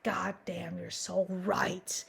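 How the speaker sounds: slow, drawn-out whisper; awed mock whisper. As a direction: whisper it as if stunningly convinced. Awed acted reply to inner voice.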